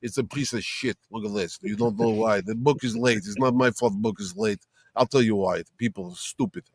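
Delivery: put-on low, quiet, thick-accented grumble; Esad Ribic impression. Artist grousing over his late book, in a low thick accent. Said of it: "I do a, a Esad Rabich".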